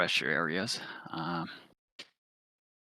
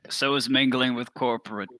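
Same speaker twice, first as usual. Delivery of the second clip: a louder, sardonic comeback; dry sarcastic jab; 5 semitones higher, 5 dB louder than usual. Acted. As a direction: throw the line back with a sneer. Snarky in-character retort.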